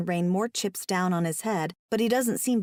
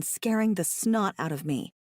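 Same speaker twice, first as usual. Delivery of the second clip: plain reading voice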